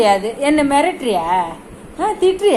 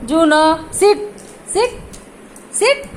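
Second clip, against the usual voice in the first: dog command. Real, not acted. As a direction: pitch each order up sharply, leaving gaps.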